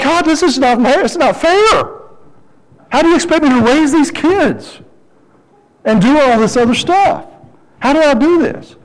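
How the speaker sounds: high-pitched acted whine of unfairness; exasperated protest. Frustrated father complaining to God about raising kids, exasperated. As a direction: plead with God as if overwhelmed.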